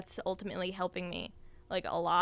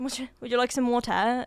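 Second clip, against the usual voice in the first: higher, louder mock British accent offering water; playful posh British accent; 4 semitones higher, 5 dB louder than usual. Showing the host a British accent on request. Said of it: "I don't know if I'm doing that right".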